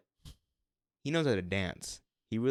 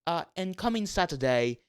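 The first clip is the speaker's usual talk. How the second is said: higher, louder British promo read; British announcer; 5 semitones higher, 7 dB louder than usual. Retold or imitated. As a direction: plug the game like a British TV announcer. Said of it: "the woman go".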